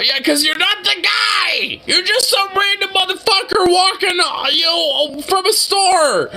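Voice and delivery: outraged shout. Real, not acted. Defending the random guy the cops beat, outraged shout. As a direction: yell at the screen in outrage.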